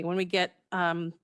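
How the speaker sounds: normal talking voice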